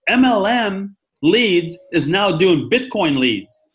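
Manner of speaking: high, slow, drawn-out big reveal; excited caller. Retold or imitated. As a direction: mimic breaking big news word by word. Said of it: "he was so excited".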